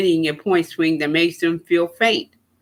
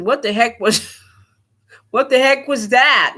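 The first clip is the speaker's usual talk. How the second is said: laughing disbelief; 6 semitones higher than usual. Real, not acted. Laughing at the scream.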